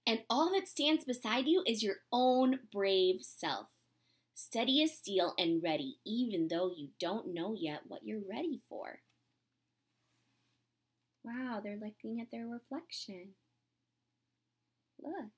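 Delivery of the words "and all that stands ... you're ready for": gentle, unhurried storybook reading; reading to children. Acted. Teacher reading a storybook to her class, gentle and unhurried. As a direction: read it like a picture book to the class.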